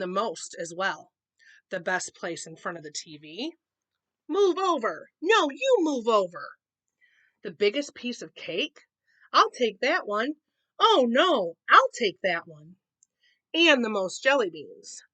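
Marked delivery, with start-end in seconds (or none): 4.3-6.5 high, loud bickering; squabbling cubs. Acted. Cub squabbling over the TV, loud bicker. 9.3-12.8 snatch it first, loudly; squabbling cubs. Acted. Cubs grabbing for the biggest cake, loud bicker.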